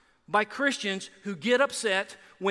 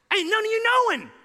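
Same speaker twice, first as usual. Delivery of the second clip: folksy Jesus; 12 semitones higher, 6 dB louder than usual. Retold or imitated. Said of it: "When Jesus done told all of us".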